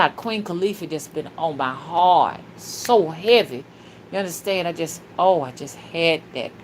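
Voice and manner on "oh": ordinary delivery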